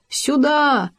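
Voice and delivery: high call; крик. A narrator's reading. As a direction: call out to a friend. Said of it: "крикнул". (громкий зов)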